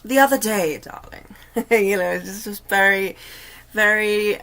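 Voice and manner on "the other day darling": put-on overly dignified British accent, "darling" trailing off to a breath; mock-grand British lady drawing out "darling"; a hint of a Jennifer Saunders British lady accent; Ab Fab accent. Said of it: "almost like an ab fab kind of accent".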